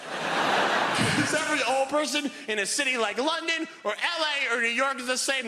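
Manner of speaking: ordinary talk, nothing marked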